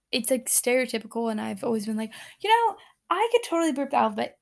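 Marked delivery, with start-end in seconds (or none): 2.4-4.4 higher, quicker mock bragging; imitated cocky boast. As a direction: quote yourself boasting, pitched up and quick. Mimics own overconfident boast.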